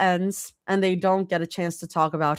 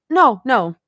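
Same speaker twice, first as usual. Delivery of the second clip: quick; 6 semitones higher, 8 dB louder than usual. A narrator's reading. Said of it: "Rumi says quickly".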